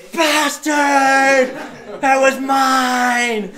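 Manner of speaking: high, loud shout, laughing through it; furious distant yell. Retold or imitated. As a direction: yell it across a couple hundred meters. Rival hitchhiker yelling from afar.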